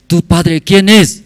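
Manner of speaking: slow, high, loud acted question; probing question. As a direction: quiz the caller forcefully. Acts president interrogating caller.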